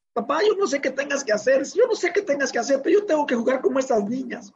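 imitating a crying girl's high, hurried plea; tearful daughter. Daughter pleading with her dad after losing, tearful imitation. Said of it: "Salió llorando y me dijo".

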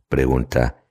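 usual speaking voice

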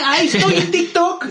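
shocked. Real, not acted. Learning his recorded audio ended up on TikTok, shocked. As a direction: sound stunned to find out.

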